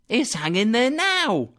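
put-on high, eager boy voice; an eager street boy. A narrator's reading. Boy replies.

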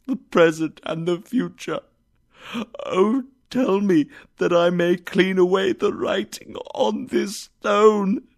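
earnest pleading. A narrator's reading. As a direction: plead to wipe the stone. Scrooge pleads to change fate.

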